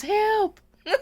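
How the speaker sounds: high, loud cry breaking into laughter; mock yell for help. Aide re-enacts calling other aides, laughing mock yell. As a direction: holler for rescue, hammed up and giggly. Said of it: "yelling for the other aides".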